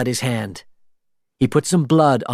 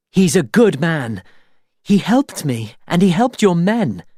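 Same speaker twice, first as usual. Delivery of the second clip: urgent plea; 7 semitones higher, 4 dB louder than usual. A narrator's reading. Elizabeth pleads for Jack.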